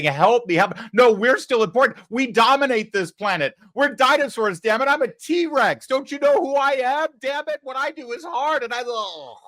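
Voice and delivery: hammed-up high, loud T-Rex whine; mock panicking dinosaur. Host mocking the media as a screaming T-Rex. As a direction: play a sinking dinosaur begging to matter.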